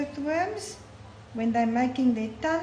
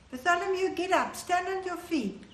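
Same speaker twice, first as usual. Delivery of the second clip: higher-pitched, lightly acted summons; retold urging; 7 semitones higher than usual. People around Bartholomew fetching him for Jesus, mild urging. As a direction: urge him up as a storyteller would. Crowd urges blind man to rise.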